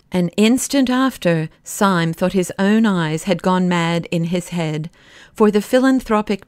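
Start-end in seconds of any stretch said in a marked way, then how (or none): none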